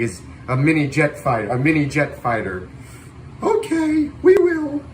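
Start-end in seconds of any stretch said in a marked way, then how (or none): 3.4-4.8 high, playful crowd voice answering back; chirpy viewer voice. Pretend. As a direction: pipe up like the eager viewers. Voicing the viewers agreeing.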